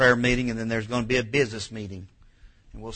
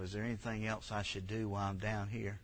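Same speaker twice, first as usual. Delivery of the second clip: much softer inner voice; thinking to yourself; 2 semitones lower, 15 dB quieter than usual. Shown as a demonstration. Pastor shows the thought to have on your knees, softly. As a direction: say it quietly, as a private thought. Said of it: "Just think to yourself".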